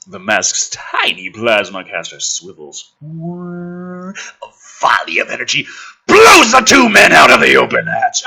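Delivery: low, measured action-movie read; dramatic script narration. Acted. Reading the movie script to the audience, dramatic narration. Reads screenplay action aloud.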